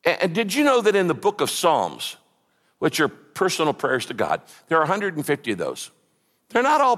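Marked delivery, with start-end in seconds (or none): none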